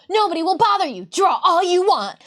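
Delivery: high, loud, grand announcer; bright magical girl. Pretend. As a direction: proclaim it like a magical fanfare. Acting out the magical desk reveal.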